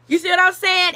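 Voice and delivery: usual speaking voice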